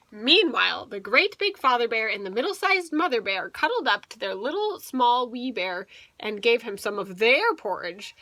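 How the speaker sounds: usual speaking voice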